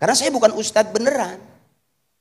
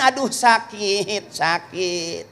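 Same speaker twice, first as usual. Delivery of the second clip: slow, drawn-out moan of pain, fading away; mock labour moan; 2 semitones lower, 4 dB quieter, much slower than usual.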